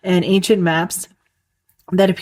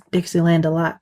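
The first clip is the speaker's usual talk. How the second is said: Southern accent. Shown as a demonstration. Podcaster showing off her Southern accent on a song title, Southern accent. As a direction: say DELIGHT the Southern way, vowel opened to "ah". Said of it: "that was my Southern accent".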